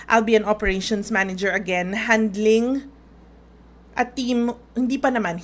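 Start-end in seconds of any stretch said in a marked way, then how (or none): none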